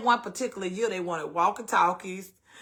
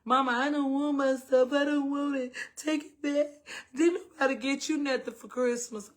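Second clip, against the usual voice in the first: put-on higher, tearful child's plea; imitating crying daughter; 6 semitones higher than usual. Daughter crying to her mother over Christmas gifts, a higher tearful plea. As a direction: replay the daughter's sobbing protest. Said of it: "just, just a holler".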